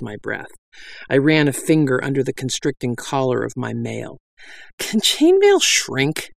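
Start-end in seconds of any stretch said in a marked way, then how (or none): 4.8-6.3 high, slow and strained. Acted. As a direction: squeeze it out as if choking. Narrator gasps in tight mail.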